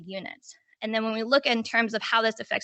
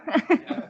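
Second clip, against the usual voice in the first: amused laugh; 6 semitones higher than usual. Real, not acted. Laughs after awkward statement.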